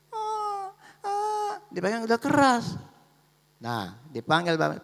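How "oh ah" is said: tiruan tangis bayi bernada sangat tinggi; tangisan bayi. Acted. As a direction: oek bayi yang dibuat-buat, melengking. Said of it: "anak itu menangis". (menirukan bayi menangis)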